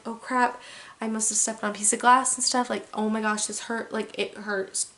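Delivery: ordinary delivery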